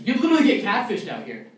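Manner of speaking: ordinary talk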